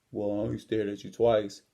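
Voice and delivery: low, subdued imitation of the bully; gym bully's meek excuse. Gym bully excusing himself for staring, imitated low and subdued. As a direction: mimic a backed-down big guy, pitched low. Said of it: "Only thing he says is".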